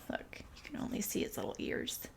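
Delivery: quick, very soft whisper; whispered aside. Pretend. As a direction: point it out in a whisper. Whispered aside about hiding cat.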